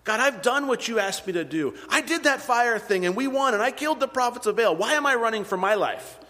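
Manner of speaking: high, pleading whine; whining. Retold or imitated. Elijah whining to the Lord about his troubles, high and whiny. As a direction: whine like a hurt child who did everything right. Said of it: "kind of a little bit whining".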